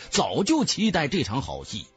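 plain reading voice

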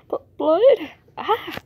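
sudden high loud gasp of fright; startled cry. Acted. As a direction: yelp in shock at the blood. Character finds blood.

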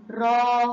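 loud, held را; long vowel demo. Shown as a demonstration. Teacher answers her question for pupils, drawn-out را. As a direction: sustain the aa of را. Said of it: "سمعنا صوت المد".

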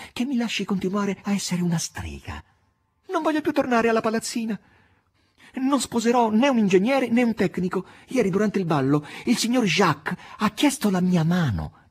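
put-on high, pleading young woman; eager maid voice. A narrator's reading. Natasha pleading with Margherita before Voland, high and eager. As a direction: beg to stay a witch, high and eager. Natasha begs to stay a witch.

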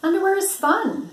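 high, bright little bird voice; cheery egret. Acted. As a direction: pipe it up like a perky bird. Egret Igor's chirpy voice.

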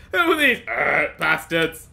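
loud question broken by a belch; everyone's burping uncle. Acted. Player imitating everyone's uncle, burpy delivery. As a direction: belch through it like a boorish uncle. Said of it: "I'm doing a great impression of, uh, everyone's uncle".

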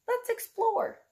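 high, eager call to explore; lively teacher's call to adventure; excited invitation. Acted. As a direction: sound thrilled to dive in. Inviting children into the book.

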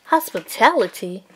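incredulous. Acted. Ken repeats the word, baffled.

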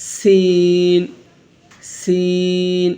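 "সিন" with a thin hissing s and long ee; thin hissing sound. Shown as a demonstration. Teacher showing the thin letter, hissing long "সিন". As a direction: hiss "সিন" thinly and hold it. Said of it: "শিরশির ও পাতলা আওয়াজ হবে".

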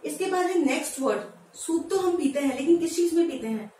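normal talking voice

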